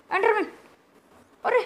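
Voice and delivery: loud, high cry of warning; startled alarm. Real, not acted. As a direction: yelp the monster's name in shock. Spots an Enderman. (घबराकर ज़ोर से चिल्लाते हुए)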